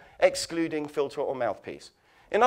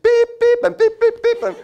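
high loud imitated beeps; bleep sound; 12 dB louder than usual. Mimicking TV censor beeps over his reaction to cameras. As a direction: beep out the swearing.

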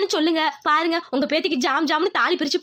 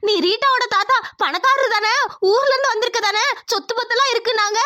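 shocked scolding; 6 semitones higher than usual. Acted. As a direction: tear into him in disbelief. Woman outraged at grandfather's secret.

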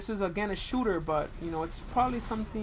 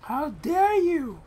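high, slow, indignant shriek; outraged fan voice; 13 semitones higher, 9 dB louder, slower than usual. Retold or imitated. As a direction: play the offended superfan. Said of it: "about to be like".

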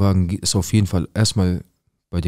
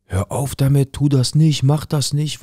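mock screaming from balcony; 6 semitones higher, slower than usual. Woman on the balcony crying out to her husband, retold as a mild mock yell. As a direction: imitate the cries from upstairs, no full scream. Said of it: "hören wir Schreie".

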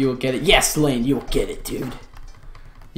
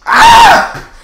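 very high piercing scream; short shriek of joy; ecstatic scream. Real, not acted. Streamer overjoyed at the shiny, ecstatic scream.

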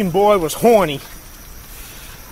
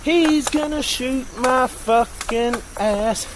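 slow mock dread; 4 semitones higher, much slower than usual. Repeat of bit.

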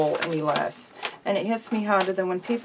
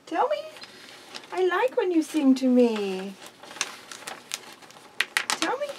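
high, coaxing sing-song pet voice; pet talk; 6 semitones higher, slower than usual. Real, not acted. Owner coaxing her pet bird to say I love you, high coaxing voice. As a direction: sweetly coax the bird to speak.

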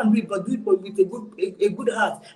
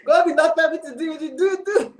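laughing; 5 semitones higher than usual. Real, not acted. Laughing through retold pastor story.

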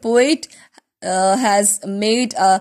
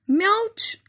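high squealed cat-like mouch; cat's cry; 10 semitones higher than usual. Acted. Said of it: "cried Ink".

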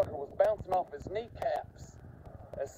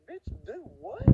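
higher, slower, stunned do what; disbelief; 9 semitones higher, 3 dB quieter, slower than usual. Retold or imitated. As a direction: drag it out in disbelief. Disbelief at the friend's request.

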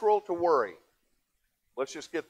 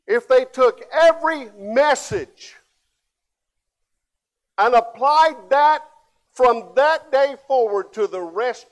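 preaching at full force; 7 semitones higher, 9 dB louder than usual. Real, not acted. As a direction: drive the point home to the congregation.

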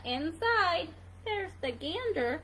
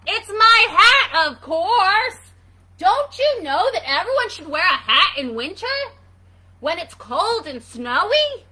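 very loud, exasperated hedgehog outburst; angry shout; 3 semitones higher, 14 dB louder than usual. Acted. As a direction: shout it, stretched out, at the end of your patience. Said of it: "Hedgie shouted".

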